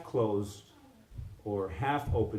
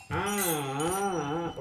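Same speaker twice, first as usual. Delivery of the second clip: higher, long rolling ahs; slow wave sound; 4 semitones higher, much slower than usual. Shown as a demonstration. Teacher showing the good slow wave, long ahs. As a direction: let each ah swell slowly like a wave. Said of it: "If the wave is like".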